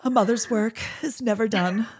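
a touch of theatrical martyrdom; dramatic martyr. Retold or imitated. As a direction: sigh it out like a long-suffering mother. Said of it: "like, real dramatic".

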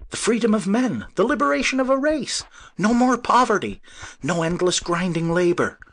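very high, loud ecstatic voice. Acted. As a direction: soar with the dream of freedom. Inventor's ecstatic vision.

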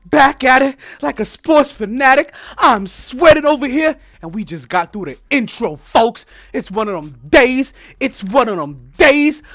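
loud, high, slow hype intro; hype announcer. Acted. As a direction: pump up the crowd.

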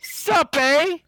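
high, loud mock Canadian "Sup, eh?"; a loud, high hammed-up Canadian accent. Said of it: "that's like a West Coast thing".

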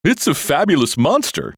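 loud, high unicorn outburst; crying out. A narrator's reading. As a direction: blurt it out excitedly. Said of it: "the unicorn cried out".

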